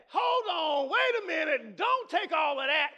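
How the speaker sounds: high, shouted objection; enemy's voice. Acted. The enemy scolding excited worshippers, preacher's shouted imitation. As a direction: cut in loudly, stretching the last demand. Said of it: "the voice of the enemy that says".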